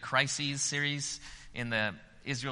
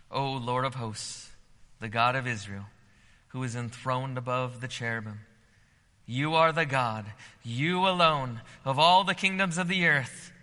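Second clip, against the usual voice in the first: rising, louder earnest plea to God; fervent prayer reading; 5 dB louder than usual. Acted. Hezekiah crying out to the Lord, fervent prayer. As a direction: pray it out like Hezekiah before God.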